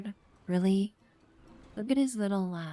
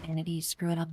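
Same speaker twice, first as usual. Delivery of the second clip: usual speaking voice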